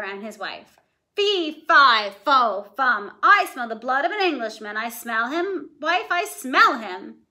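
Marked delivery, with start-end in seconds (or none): 1.2-7.3 put-on loud, menacing giant sniffing out a boy; loud ogre chant; booming ogre. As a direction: boom the threat, slowing at the end. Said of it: "when the ogre spoke".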